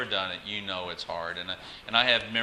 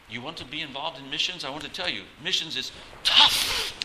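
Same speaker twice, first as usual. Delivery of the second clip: imitating South African accent; 6 semitones higher, 4 dB louder than usual. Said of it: "Phil would always say".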